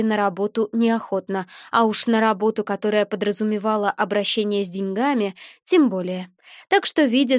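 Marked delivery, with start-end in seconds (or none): none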